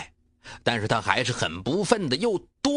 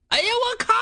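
very high startled outburst; surprised exclamation; 4 dB louder than usual. Acted. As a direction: caught off guard, yelp it. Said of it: "有点惊诧于大志的强硬".

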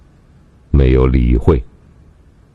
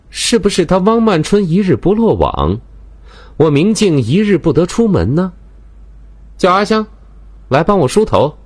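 higher, scornful lady of the house. Acted. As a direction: scoff at the danger, then give orders. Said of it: "明镜冷哼了一声".

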